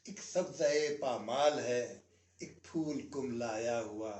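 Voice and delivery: slow, lingering nazm recital, every line weighed; poem recitation. Acted. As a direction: recite the old nazm with a pause after each line. (ठहर-ठहर कर नज़्म पढ़ना)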